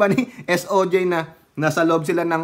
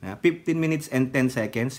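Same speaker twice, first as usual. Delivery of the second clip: ordinary talk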